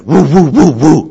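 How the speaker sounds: barking. Shown as a demonstration. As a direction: bark to set the dog off. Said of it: "I usually use just barking myself".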